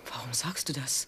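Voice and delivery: low, soft, scared whisper; scared wife whispering to her threatening husband; frightened whisper. Acted. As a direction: barely dare to ask it.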